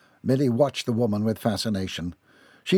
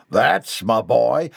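loud, slow, drawn-out cheer; proud hillbilly father; 6 dB louder, much slower than usual. A narrator's reading. Proud father cheers on his son.

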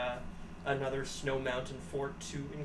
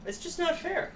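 mock whine. Real, not acted. Co-host whining high to host about being left out.

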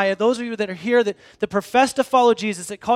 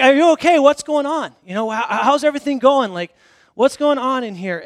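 calling through door; 4 semitones higher, 5 dB louder than usual. Retold or imitated.

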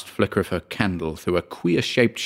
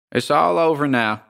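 higher, lightly drawled landlady voice; understated folksy drawl, pitched up; metallic drawl; 7 semitones higher than usual. A narrator's reading. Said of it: "drawled the metallic voice behind him".

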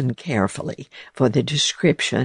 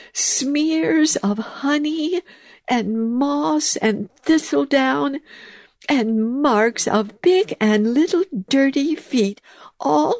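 much higher, slow, dismayed mouse voice; 10 semitones higher than usual. A narrator's reading. Mouse lamenting her dirty house, high and dismayed. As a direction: wail over the mess.

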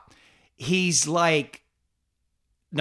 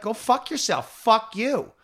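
resentful retort; 6 semitones higher, slower than usual. Retold or imitated. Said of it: "not to feel some fucking resentment".

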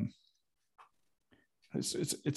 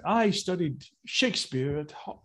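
posh English actor voice; lightly hammed Shakespearean English accent; 5 semitones higher than usual. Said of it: "Shakespearean train".

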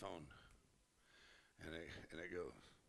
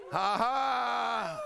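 loud, high whooping laugh; shouted laugh; 9 semitones higher, 6 dB louder than usual. Real, not acted.

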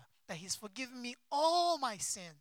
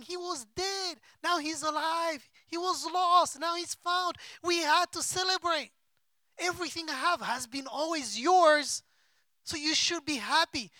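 higher and louder, urgent pleading; impassioned father's plea; 6 semitones higher, 5 dB louder than usual. Retold or imitated. Father begging older son to celebrate, raised urgent voice.

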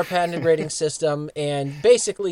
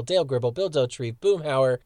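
ordinary delivery

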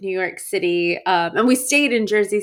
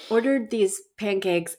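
ordinary talk

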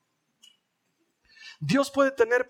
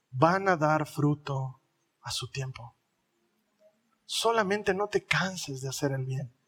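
gentle exhortation; 6 semitones lower, 3 dB quieter than usual. Real, not acted. Pastor gently urging parents to be patient, low and calm.

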